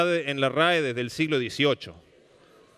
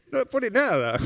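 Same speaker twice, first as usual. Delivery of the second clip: mock incredulous; 8 semitones higher than usual. Mimics the censor leaving blank.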